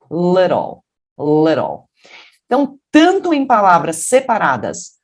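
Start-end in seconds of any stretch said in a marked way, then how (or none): 0.1-1.9 "Little" with a tapped R in the middle. Shown as a demonstration. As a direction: say "Little" as "le-rol". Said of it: "a gente vai fazer é o R". ("Little" com som de R no lugar do T)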